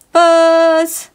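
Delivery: loud high buzzing mosquito noise. Acted. Reader buzzes like a mosquito for the kids, loudly. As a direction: buzz like a mosquito at full volume.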